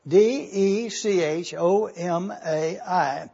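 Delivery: slow, letter by letter; deliberate spelling. Real, not acted. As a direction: spell it out slowly so everyone can follow. Spells it again for careful looking.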